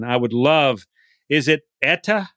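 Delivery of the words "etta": "Etta" with a true T sound; crisp T pronunciation. Shown as a demonstration. Host checking the guest's wife's name, crisp T. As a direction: say "Etta" with the t fully released. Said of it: "it's a T, we know, but it's a D".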